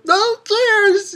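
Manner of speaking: very high-pitched squeaky interjection; mock interrupting cheer. Pirate interrupts king's speech, high mock cheer. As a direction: cut in with a high, excited cheer.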